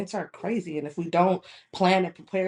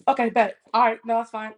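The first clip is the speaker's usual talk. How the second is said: louder, higher mock huffy retort; instant attitude; 4 semitones higher, 8 dB louder than usual. Friend told to go without her, imitated with attitude. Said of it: "it's her attitude".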